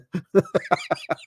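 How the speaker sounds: breathy burst of laughter. Real, not acted. Vlogger cracks up after the Reagan bit, breathy burst of laughter. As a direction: crack up at your own joke.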